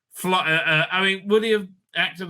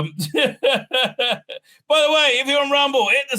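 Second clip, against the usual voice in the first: laughing; 9 semitones higher, 4 dB louder than usual. Real, not acted. Laughs mid aside.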